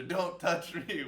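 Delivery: put-on slow, high, loud yell of a kid; retold yell. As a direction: yell it out slowly like a boy stuck on a fence. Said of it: "just yelling at the teacher".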